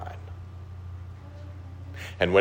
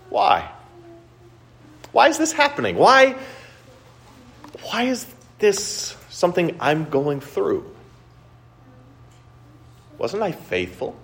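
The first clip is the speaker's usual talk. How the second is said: John the Baptist's anguished questions; 11 semitones higher than usual. Retold or imitated. Imprisoned John the Baptist questioning God, anguished and pleading. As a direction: cry out like a prisoner asking God why. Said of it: "his mind was filled with questions".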